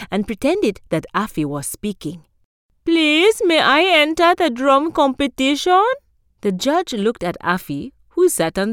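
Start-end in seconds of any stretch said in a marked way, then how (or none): 2.8-5.8 put-on high, loud eager boy plea; tortoise faking a boy; pretend boy voice.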